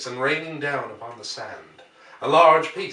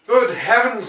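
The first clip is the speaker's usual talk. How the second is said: loud, high startled cry; a man shocked by what he sees; excited exclamation; 8 semitones higher, 7 dB louder, slower than usual. Acted. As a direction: blurt it out in alarm. Ogilvy exclaims at the cylinder.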